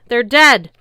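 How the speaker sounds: loud, blunt shout. A narrator's reading. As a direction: hurl the news at him. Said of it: "I shouted".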